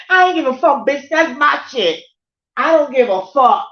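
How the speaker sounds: loud, high, furious rant; heated. Real, not acted. Reliving the stolen shrimp fight, furious loud yelling. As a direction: yell it, still heated. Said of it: "I was fucking heated".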